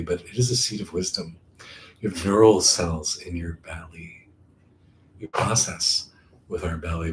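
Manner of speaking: calm meditation voice with long, settling pauses; guided meditation. Acted. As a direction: guide them inward, unhurried.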